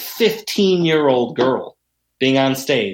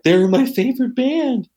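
put-on high, small voice of a confused girl; imitating crying girl; 10 semitones higher than usual. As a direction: teller imitating the crying fan.

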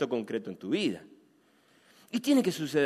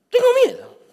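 very high, loud comic whimper of fear; hammed-up frightened cry; mock fearful squeak; 9 dB louder than usual. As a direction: squeal it like a scared kid. Punchline admitting fear.

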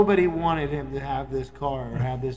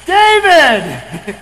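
sudden loud, high yell imitating a recording; yelled name clip; 10 semitones higher, 14 dB louder than usual. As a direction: blast the name out like a recorded shout. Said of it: "they play the clip of his voice".

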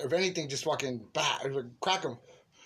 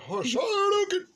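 squeaky, louder mocking voice; 4 dB louder than usual. Jose teasing his friend with a squeaky, louder mocking voice. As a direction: mimic the friend to tease him.

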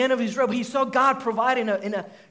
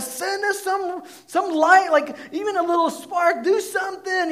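mock pleading; 9 semitones higher than usual. Preacher mimicking Baal priests begging for fire, high mock plea. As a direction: ham up the desperate plea to the sky.

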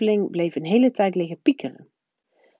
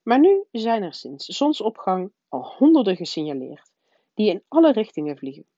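ordinary delivery

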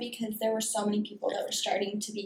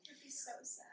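soft, breathy whisper of sympathy; a hushed, pitying whisper to a friend; whispered aside; 3 semitones higher, 24 dB quieter than usual. Real, not acted. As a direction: whisper it under your breath. Sympathetic aside while friend talks.

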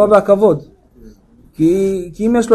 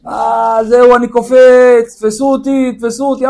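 mock wail of a man threatening to jump; desperate rooftop jumper; 3 semitones higher, slower than usual. Man on the roof warning onlookers he'll jump, drawn-out mock desperation. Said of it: "אתם מכירים את זה שעלה לגג".

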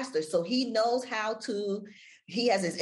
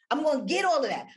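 higher, louder, punching all; passionate burst; 8 semitones higher, 4 dB louder, faster than usual. Shown as a demonstration. S0 showing listeners how passion comes through, higher and louder. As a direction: lift it with passion. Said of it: "If you're passionate about something".

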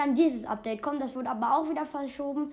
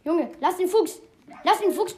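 louder, higher yelling at a bad dog; panicked higher scolding yell; scolding shout; 5 semitones higher, 9 dB louder than usual. Real, not acted. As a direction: shout the wolf off the fox. Scolding wolf attacking his fox.

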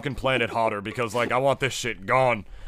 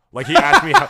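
much higher and louder burst; excited; 10 dB louder than usual. Real, not acted. Telling friends about the kid at the mall, excited and high. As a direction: jump up with excitement.

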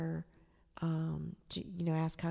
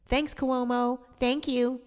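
bitter, sarcastic thanks, higher and louder; 6 semitones higher, 6 dB louder, slower than usual. Real, not acted. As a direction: thank him with a sneer. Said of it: "put down the middle finger".

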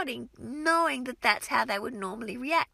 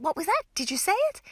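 playful teacher asking; 7 semitones higher, 3 dB louder, faster than usual. Shown as a demonstration. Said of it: "put my hand to my ear and ask them".

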